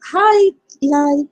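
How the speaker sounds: usual speaking voice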